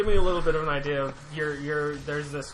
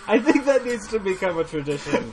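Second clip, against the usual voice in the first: laughing; 5 semitones higher, 7 dB louder than usual. Real, not acted. Laughing at the joke.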